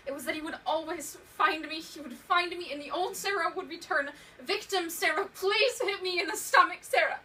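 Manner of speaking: loud, high, frantic outpouring; near-hysterical dread at full volume; panicked despair. Acted. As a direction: spiral into terror, voice climbing. Dread of abuser's return, breaking down.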